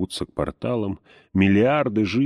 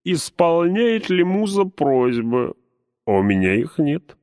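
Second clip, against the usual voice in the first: anguished poet; 7 semitones higher than usual. A narrator's reading. Poet's anguished outburst.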